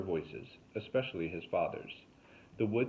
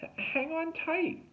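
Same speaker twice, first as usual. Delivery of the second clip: urgent call; slower than usual. A narrator's reading. Calling up to dangling Derek.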